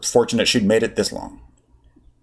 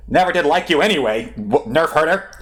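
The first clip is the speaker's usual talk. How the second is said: a touch of sudden spite, higher; snide; 7 semitones higher than usual. Acted. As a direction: switch to a rude droid jab. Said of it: "in a decidedly different tone".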